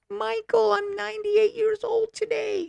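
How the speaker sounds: put-on high, thin old lady voice; frail old woman. Lena telling Michael her age, in a high frail old voice. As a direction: play a tearful ninety-eight-year-old woman, high and frail.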